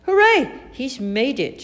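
high, loud hooray easing off; shouted cheer. A narrator's reading. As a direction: cheer in triumph. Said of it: "shouted Kipper".

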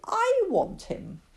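put-on high, drawn-out childish plea; crying out. Lily tells her mother she wants the cat, anguished cry. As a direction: claim him with a slow wail. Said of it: "cried Lily".

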